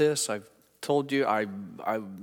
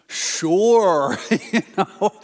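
loud, higher, stretched-out yes; starstruck eagerness; 6 semitones higher, 6 dB louder, much slower than usual. Retold or imitated. Pastor accepting his hero's offer of prayer, comically over-eager. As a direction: blurt it long and over-eager.

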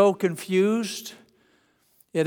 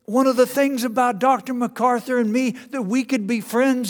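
much higher and louder animated preacher voice; John Piper voice; 8 semitones higher, 5 dB louder than usual. Retold or imitated. Piper remembering his friendship with MacArthur, high and eager. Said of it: "he's got a high-pitched voice".

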